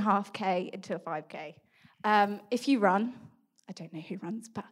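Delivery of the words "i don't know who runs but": a quick whispered aside. Real, not acted. To podcast listeners who run, whispered aside.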